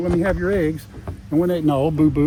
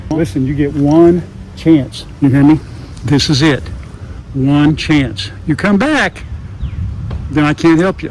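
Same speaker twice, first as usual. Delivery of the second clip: loud, slow mock-stern telling-off; mock stern warning; 8 dB louder than usual. To the rat snake before release, mock-stern loud warning. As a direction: lay down the law to a snake, half joking.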